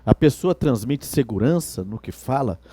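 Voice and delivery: usual speaking voice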